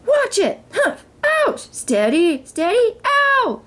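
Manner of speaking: high, strained yelps under her weight; the three groaning under the princess; chorus of strained cries. A narrator's reading. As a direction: grunt and yelp as she climbs. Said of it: "the general chorus".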